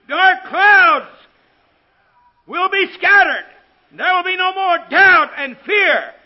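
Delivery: high, shouted preacher's proclamation; ringing phrases with pauses between; full-force preaching. Real, not acted. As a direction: hammer out each promise from the pulpit, loud and high. Preaches promises in mounting list.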